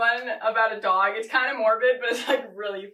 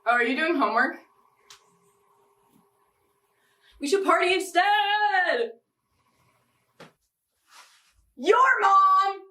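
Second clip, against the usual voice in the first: high, loud goofy voice; mocking roommate voice; 9 semitones higher, 5 dB louder than usual. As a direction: mimic an annoying roommate. Roommate Becca mimicked.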